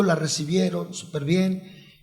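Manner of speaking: normal talking voice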